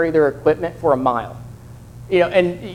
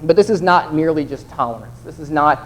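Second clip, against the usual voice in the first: ordinary talk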